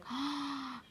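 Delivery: shocked gasp. Retold or imitated. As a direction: act out a gasp of dread. Retelling her shock seeing him.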